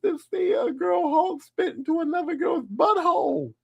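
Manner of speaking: high, loud, slow incredulous whine; mock outrage at a porn video; outraged disbelief. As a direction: howl it like you can't believe what you're seeing. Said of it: "And I'm like".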